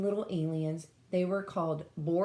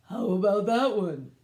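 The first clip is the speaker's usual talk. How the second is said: louder, bright alien question; Gork's voice; 3 semitones higher, 7 dB louder than usual. Acted. Gork points at a planet, loud and eager. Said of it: "asked Gork".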